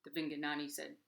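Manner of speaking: ordinary delivery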